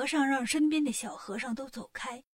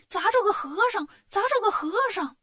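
higher, louder excited shout; crowd shouting; 7 semitones higher, 6 dB louder than usual. Acted. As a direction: yell it twice to the whole street. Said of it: "就喊".

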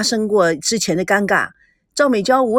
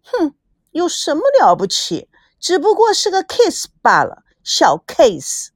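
higher-pitched, scoffing bravado; defiant; 6 semitones higher than usual. A narrator's reading. Teased girl refuses to back down about the kiss, defiant. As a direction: brush it off defiantly, voice lifted. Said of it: "美娇不甘示弱".

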